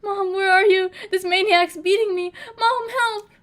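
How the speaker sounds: high, loud whiny cry for help; mock childish wail. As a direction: whine like a kid calling mom. Mock whining for mom.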